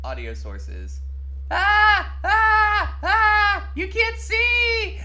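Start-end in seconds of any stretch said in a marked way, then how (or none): 1.5-3.7 shrill high yelps, very loud; high panicked shouts. Real, not acted. Panicked shouts over broken stream. 3.8-5.0 very high, slow, loud wail; high-pitched wail. Pretend. High-pitched wail, then low take.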